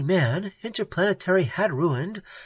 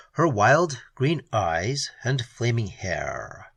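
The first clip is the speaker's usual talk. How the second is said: plain reading voice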